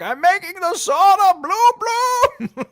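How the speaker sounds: loud, high, slow proud shout through a laugh; a hammed-up gleeful yell at the lady upstairs; excited shout. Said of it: "I'm like".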